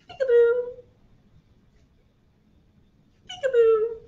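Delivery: loud, high playful bursts; playful peekaboo calls. Pretend. Storyteller playing peekaboo with toddlers, playful loud calls.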